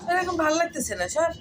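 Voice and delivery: loud, high scolding; shooing shout. Real, not acted. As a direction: chase the cat off loudly. Shouting the other cat away. (রেগে চিৎকার করে বিড়াল তাড়ানো)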